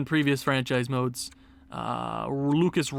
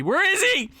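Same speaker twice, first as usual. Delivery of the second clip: panicked searching, pitched way up; freaking out; 12 semitones higher, 3 dB louder than usual. Real, not acted. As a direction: voice leaps into a high frantic cry. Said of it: "I'm freaking out".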